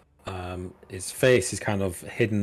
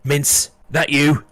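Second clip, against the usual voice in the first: slow, loud guard calling out; gruff guard call; 6 semitones higher, 7 dB louder, slower than usual. Acted. Guard calls out in voiced role.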